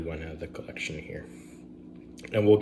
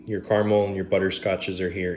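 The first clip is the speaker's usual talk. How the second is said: ordinary talk, nothing marked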